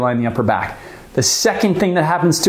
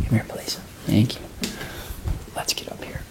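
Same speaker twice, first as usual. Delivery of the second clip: very soft, half-whispered coaxing; hushed aside; 5 semitones lower, 11 dB quieter than usual. Real, not acted. Teacher calling Olive over mid-class, hushed aside. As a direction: drop to a near-whisper, off to the side.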